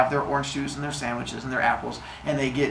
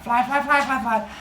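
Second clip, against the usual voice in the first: high, squeaky fly voice; put-on bug chant, pitched up; tiny fly; 9 semitones higher than usual. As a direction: flit through it as a little insect. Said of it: "He's like".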